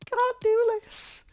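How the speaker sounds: high, whining mock outrage from a hard-done-by old manager; mock Neil Warnock whine. Cardiff boss complaining about the transfer fee, high mock whine. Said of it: "Sorry, the voice crack".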